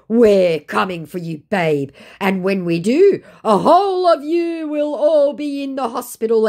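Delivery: hammed-up ominous threat; mock scammer threat. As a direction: threaten like a cult villain. Voicing scammer's death threat.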